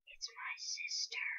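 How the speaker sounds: slow, soft unvoiced whisper. Player spotting the girl panda, slow secret whisper. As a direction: breathe it out slowly as a secret.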